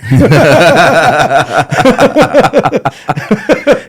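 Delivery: loud, high burst of real laughter; big loud laugh. Real, not acted. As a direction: laugh hard at your own story. Laughs at own story.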